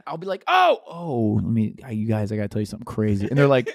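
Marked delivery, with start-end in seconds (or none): 0.5-1.4 catching himself. Acted. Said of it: "tone it down". 1.4-3.3 low, louder close-mic confiding voice; cock voice. Acted. Gamer dad telling friends something crazy, low cock voice. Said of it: "here comes Cock Voice".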